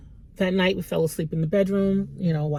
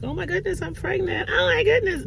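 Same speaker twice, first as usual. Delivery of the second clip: much higher, squealing mock joy; mock excited gasp. Mother mocking effortless pregnancy news, squealy. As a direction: play the easy surprise pregnancy.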